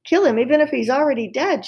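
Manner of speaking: a mock yell at the maid; shouted. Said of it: "shouted the cook".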